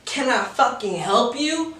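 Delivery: lightly voiced snippy girl on the phone; a hint of a rude drunk girl brushing him off; mocking her attitude. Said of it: "And so she goes".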